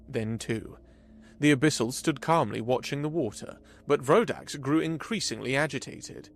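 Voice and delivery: ordinary delivery, nothing marked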